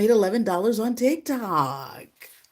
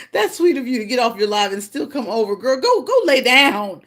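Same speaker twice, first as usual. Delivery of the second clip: touched gratitude; 5 semitones higher, 6 dB louder than usual. Real, not acted. Thanking viewer who came over.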